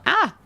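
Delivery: high squeak trying out a voice; shrill test squeal. Shown as a demonstration. Streamer warming up for the goblin queen's shrill voice. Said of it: "it's gotta be shrill".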